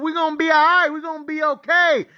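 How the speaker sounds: high, insistent pep talk; hopeful self-reassurance. Retold or imitated. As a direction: convince yourself it will be fine. Psyching himself up at halftime.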